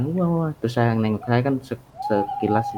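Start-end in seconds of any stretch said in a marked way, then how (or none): 0.0-0.5 eerie repeated wah wah, pitched up; muffled wah wah talk. Retold or imitated. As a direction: imitate a distant ghostly wah wah. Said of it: "muncul lagi suaranya".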